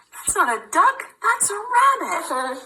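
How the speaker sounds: lightly voiced storybook rebuttal; playful mock contradiction. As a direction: contradict like a silly game for a baby. Arguing voices in book.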